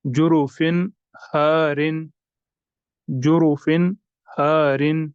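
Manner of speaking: "जुरुफिन हारिन" slow, न of जुरुफिन sounded; careful recitation; clear tanween. Shown as a demonstration. Teacher recites for students. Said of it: "यहाँ पर भी होगा इजहार".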